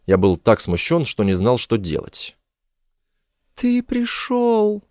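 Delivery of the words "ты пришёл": very high, slow woman's voice; high-pitched weepy relief; tearful cry. A narrator's reading. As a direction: draw the words out high, overcome with tears. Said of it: "заплакала она".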